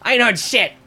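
high, loud smug cop; Jenkins' retort. Acted. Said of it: "And Jenkins goes".

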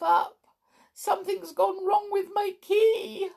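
high, thin toy clown voice; Clockwork Clown voice. Acted. Clown answering Tiptoe from his bed, high toy voice. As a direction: play the sad little clockwork clown.

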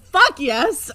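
yelling with glee, laughing; excited shout. Real, not acted. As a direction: cheer it out loud and high. Cheering Skye's punch.

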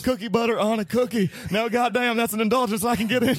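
Stone Cold impression. Continues Stone Cold parody.

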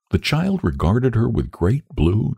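usual speaking voice

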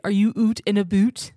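mock Canadian accent. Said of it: "you always say".

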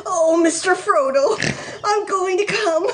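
high, strained exclamation; urgent grunted cry. A narrator's reading. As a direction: act overwhelmed, pitch pushed right up. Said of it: "he grunted".

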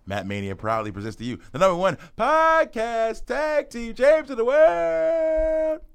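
booming ringmaster announcement, stretched out. Acted. Announcer intro for podcast.

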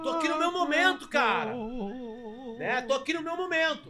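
high-pitched exasperated protest; mock-indignant high whine; acted exasperation. Acts out annoyance at being interrupted.

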